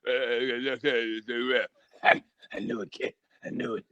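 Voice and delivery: put-on soft, whispery gasping old trainer; breathless old man. Mocking Mickey dying of covid, whispery and breathless. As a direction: wheeze it out like he can't breathe. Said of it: "He go".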